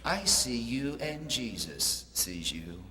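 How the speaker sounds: an unseen voice murmuring from the shadows; the hidden parrot speaks to the burglar in a low, eerie voice; eerie voice from the dark. Acted. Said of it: "he hears this voice that says".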